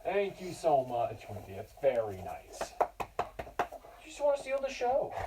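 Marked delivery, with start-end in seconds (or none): 2.8-5.1 teasing pet talk. Acted. Teacher teasing dog in pet voice.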